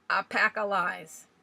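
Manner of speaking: loud, slow, hammering each word; slow emphatic outburst. A narrator's reading. Old Swales hammering the tombstone, loud and slow. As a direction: bang out the words like the stick on stone.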